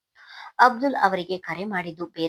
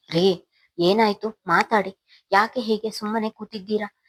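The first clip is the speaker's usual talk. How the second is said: plain reading voice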